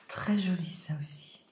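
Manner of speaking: admiring whisper. Real, not acted. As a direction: whisper it in awe. Admire en chuchotant. (murmure admiratif chuchoté)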